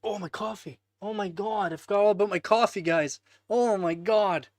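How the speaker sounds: high, loud oh my God; suddenly remember the forgotten coffee; dismayed surprise. Real, not acted.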